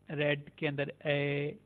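normal talking voice

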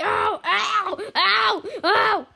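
loud, high repeated "ow"; pained yelps. Pretend. Player begging not to be hit, high pained yelps. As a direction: yelp sharply at each hit.

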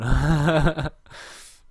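big amused laugh; loud laugh. Real, not acted. Laughs at own English.

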